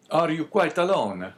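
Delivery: put-on Italian accent. A narrator's reading. Guest inquiring of his host, Italian accent. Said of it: "speaking with a strong Italian accent".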